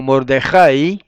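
Mordejai slow and loud, accent on the A; stress on A. Shown as a demonstration. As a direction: say Mordejai as mor-de-JAI. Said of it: "lleva el acento en la A".

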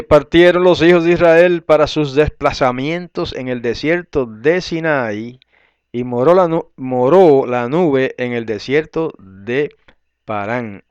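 loud, measured Bible reading; formal, deliberate reading aloud; scripture reading. Real, not acted. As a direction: read the verse out firmly, leaning on key words. Reads the verse aloud.

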